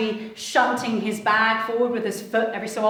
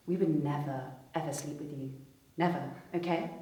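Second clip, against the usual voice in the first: much softer, cold and low; leaning in, hushed; 5 semitones lower, 10 dB quieter, faster than usual. Retold or imitated. Model leans in to the man, hushed and cold. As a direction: lean in and say it low. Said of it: "she leans in and goes".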